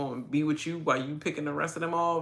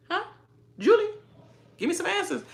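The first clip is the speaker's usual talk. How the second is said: much higher pitched, demanding; high exasperated plea; faster than usual. Real, not acted. Fan begs the host Julie for answers, high and exasperated. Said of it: "pleading to host".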